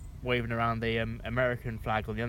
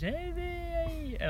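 squeaky falsetto, slowed right down; high-pitched voice. Retold or imitated. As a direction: strain up into a thin high voice. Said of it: "his little high pitched voice".

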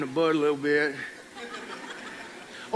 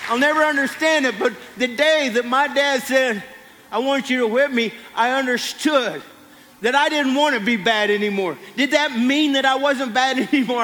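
full-force preaching; 5 semitones higher than usual. Acted. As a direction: preach it to the whole church. Preacher continues.

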